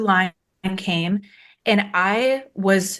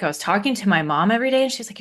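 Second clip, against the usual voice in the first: ordinary talk, nothing marked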